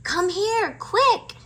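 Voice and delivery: high, loud hurried call; urgent shout. A narrator's reading. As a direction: make it sound urgent. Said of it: "Annie shouted".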